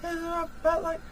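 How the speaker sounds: put-on very high, thin boyish pitch; kid's high voice. Shown as a demonstration. Showing viewers how high the kid's voice is, very high. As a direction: pitch it way up like a young boy. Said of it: "versus, like".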